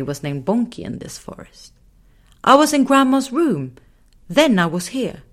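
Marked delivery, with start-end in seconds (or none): 2.4-5.2 put-on higher little girl explaining; bewildered girl. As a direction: explain it simply, puzzled. Belle explains.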